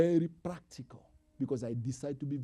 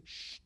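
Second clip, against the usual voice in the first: shushing; 14 dB quieter than usual. Shown as a demonstration. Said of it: "you say".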